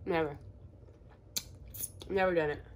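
talking behind hand while chewing; muffled eating speech; mouth full, chewing. Real, not acted. Seen 0: Food goes in at -1; she covers her mouth while talking.

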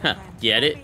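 ordinary talk

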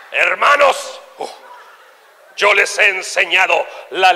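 slow, solemn mock sermon with stretched words; preaching pastor. Pastor praying and teaching his congregation, solemn vibrating delivery. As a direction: draw out each phrase, dropping low at the ends. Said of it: "Estaba el pastor así, orando en modo vibrador".

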